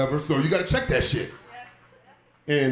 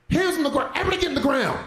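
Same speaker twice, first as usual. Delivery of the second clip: higher, barking mock police order; imagining storming in like a cop, higher shouted order; cop command; 11 semitones higher, faster than usual. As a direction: bark it like a raiding officer.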